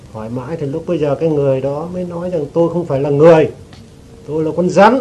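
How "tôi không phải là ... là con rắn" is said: louder, slightly raised retold outburst; voicing a snake spirit's loud protest; retold emphatic declaration. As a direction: insist forcefully, as the possessed person. Possessed person declares itself a snake.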